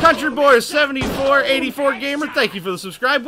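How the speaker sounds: high, loud greeting to the chat; hyped shout-out. Real, not acted. Streamer greeting a new subscriber, loud and excited. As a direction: boom it out like an announcer.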